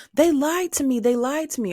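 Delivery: high, loud cry of betrayal; exasperated outcry. Retold or imitated. Her past self complaining about being lied to, exasperated cry. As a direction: wail it like you've been cheated. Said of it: "I used to say all the time".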